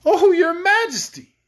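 high, loud, drawn-out plea; pleading. A narrator's reading. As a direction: beseech the queen for mercy. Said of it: "pleaded poor Rinkitink".

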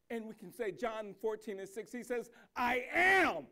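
slow, loud, ringing proclamation; full-force preaching. Acted. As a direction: proclaim it to the whole church.

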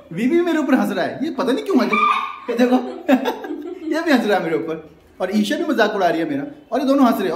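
can't stop laughing while complaining. Real, not acted. Everyone laughing at his shave.

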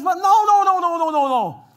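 high, loud desperate no; frantic shout. Retold or imitated. Lot shouting at the crowd outside, frantic plea. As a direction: plead at the top of the lungs. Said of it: "So Lot turns around and says".

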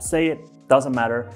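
usual speaking voice

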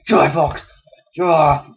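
loud battle cry at the fox; shouting. Acted. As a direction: yell it as you attack. Killing a fox in game.

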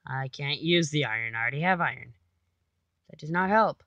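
ordinary talk, nothing marked

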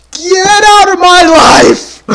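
high, loud outburst of rage; furious yell. Real, not acted. Player yelling at attacking monsters, furious yell. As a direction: yell it at an enemy you can't stand.

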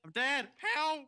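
high, loud cry for help; panicked yell. Real, not acted. Teammate dying in game.

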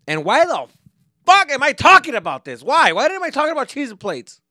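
loud exasperated. Real, not acted. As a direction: throw up your hands and shout why. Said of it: "exasperated self-question".

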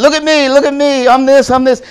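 high, loud look-at-me bragging; showoff voice. Retold or imitated. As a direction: brag loudly, high and pleased. Showoff character in horror film.

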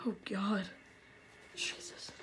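soft breathless whisper; scared whisper. Real, not acted. Gamer whispering in dread.

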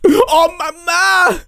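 high, loud, drawn-out sobbing wail; mock tearful breakdown. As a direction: blubber to mother in hammed-up tears. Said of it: "he breaks down in tears".

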